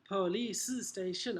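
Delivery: slow "Police station", extra vowel after "Police"; poor pronunciation. Acted. Teacher mimicking pupils' mistake, poor pronunciation of "Police station". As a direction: imitate a learner's laboured "police-uh station". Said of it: "Poor pronunciation".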